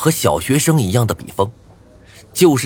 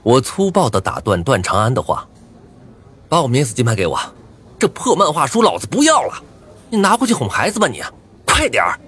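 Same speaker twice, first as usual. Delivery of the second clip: rude interruption; 4 semitones higher than usual. Acted. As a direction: cut him off roughly.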